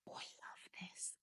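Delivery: breathless whisper of delight. Real, not acted. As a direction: whisper it like a secret joy. Smelling a fragrance she loves.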